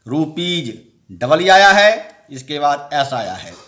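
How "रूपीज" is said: रूपीज ending in a clear ज sound; final s as j. Shown as a demonstration. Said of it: "तो जौ होगा".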